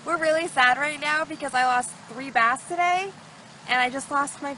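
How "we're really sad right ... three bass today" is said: usual speaking voice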